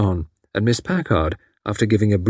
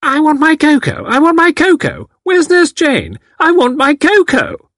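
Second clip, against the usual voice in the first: put-on calling out very high and loud like a tiny old lady; demanding cocoa in a high, loud, childish wail; little old woman loudly calling for her cocoa; loud shrill old woman; 5 dB louder than usual. A narrator's reading. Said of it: "calling in a loud, shrill voice".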